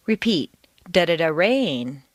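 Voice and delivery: "da-da-da" kept light and low, "rain" hit hard; stress on rain. Shown as a demonstration. Teacher shows the learner where to stress rain. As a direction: mumble the filler, land on rain. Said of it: "replace everything else with da-da-da".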